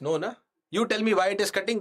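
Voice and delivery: ordinary talk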